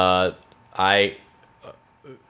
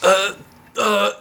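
higher, hammed-up anime cry; having a heart attack; 8 semitones higher than usual. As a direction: fake dramatic Japanese line. Continuing the anime bit.

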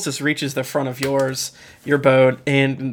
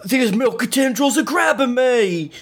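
yelling; 9 semitones higher than usual. Acted. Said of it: "someone yells".

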